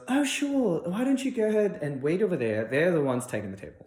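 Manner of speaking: high, put-on British lady voice; English hostess. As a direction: imitate a British hostess, starting high and settling.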